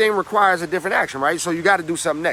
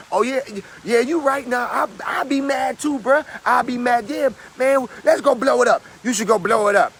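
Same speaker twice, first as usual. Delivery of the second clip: high, eager egging-on; hyped-up friend; 7 semitones higher than usual. Retold or imitated. Friend egging you on, high and hyped. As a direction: pump them up to blow it up. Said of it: "So it's like".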